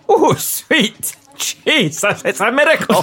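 awed exclamation. Acted. Reading a festive script line.

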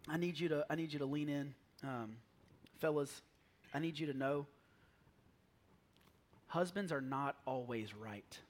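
confiding secret. Shown as a demonstration. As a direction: drop the voice as if letting the men in on something. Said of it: "if I can tell you a secret".

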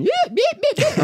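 high whooping yeahs, imitating the hunters; mock hunter yelling. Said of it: "yelling and going".

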